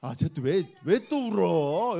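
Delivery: put-on slow, fed-up groan of a put-upon older sibling; mock exasperated "why is he crying again"; annoyed sibling. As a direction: drag it out, irritated with the crier. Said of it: "이렇게 해야 돼".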